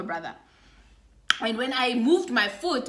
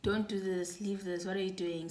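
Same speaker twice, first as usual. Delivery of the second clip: much softer and lower, urgent; calm the sister down under your breath; soft, urgent hush; hushed pleading; 4 semitones lower, 12 dB quieter than usual. Retold or imitated. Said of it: "whispering in her brother's ear".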